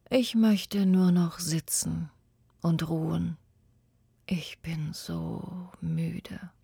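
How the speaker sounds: soft, voiceless, slowing to a halt; an old man too tired to go on; weary whisper. Acted. Old boy tells the tree he only wants rest, weary whisper.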